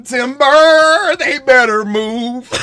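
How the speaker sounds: loud, high "Timber" call; mock yelling. Players calling out as teammates fall, mock yell. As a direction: call it like a lumberjack warning. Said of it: "They yelling".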